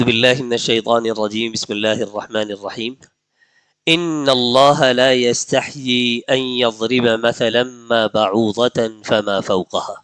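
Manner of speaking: slow, drawn-out tajweed recitation of the verse; Quranic recitation. Shown as a demonstration. Teacher to his students, reciting the verse in slow tajweed style. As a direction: recite it as in prayer, stretching the nasal sounds. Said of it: "ایک آیت کریمہ ہے، مشہور بھی ہے".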